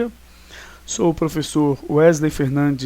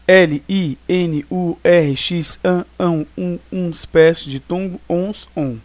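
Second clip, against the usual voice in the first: letter by letter; 3 semitones higher, 4 dB louder, slower than usual. Shown as a demonstration. Teacher listing the endings that take a graphic accent, letter by letter. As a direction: spell each ending out, evenly separated. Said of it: "é letra por letra".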